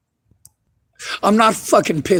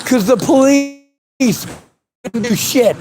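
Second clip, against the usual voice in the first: rising anger; 5 semitones higher than usual. Real, not acted. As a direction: let the fury build as the grievances pile up. Furious rant about broken promises.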